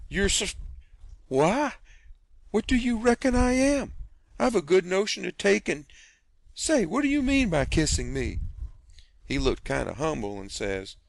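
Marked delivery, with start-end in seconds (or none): 0.1-8.4 high, loud, stammering indignation; flustered aunt scolding a cheeky stranger; sputtering outrage. Acted. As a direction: choke on your own fury.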